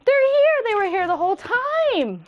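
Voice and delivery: put-on a kid ranting about toys that appeared from nowhere; mock outrage. [name]'s rant peaks.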